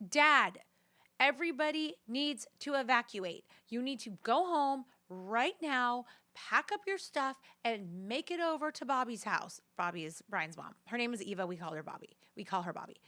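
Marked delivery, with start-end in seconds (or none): 0.1-0.6 loud, sharp call of Dad; urgent call. Retold or imitated. Daughter calling out to her dad about the evacuation, loud and urgent. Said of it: "I'm like". 1.2-9.6 urgent instruction. Retold or imitated. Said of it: "I'm like".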